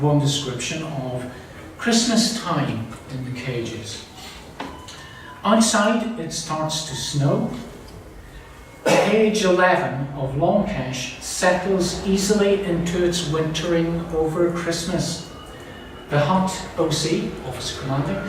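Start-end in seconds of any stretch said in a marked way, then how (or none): 5.4-17.3 slow, raised-pitch story narration; measured literary reading; reading aloud. Acted. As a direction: read the passage out deliberately, phrase by phrase. Reads prisoner's account aloud.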